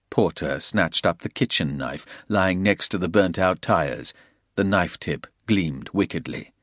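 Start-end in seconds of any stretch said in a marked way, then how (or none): none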